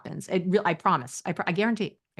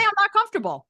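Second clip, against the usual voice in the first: much higher, louder mocking take of I'm not comfortable; mock squeaky protest; 13 semitones higher, 4 dB louder, faster than usual. Shown as a demonstration. Coach mocking a weak excuse in a squeaky voice. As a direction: mimic the weak excuse, pitched way up. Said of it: "Don't even say".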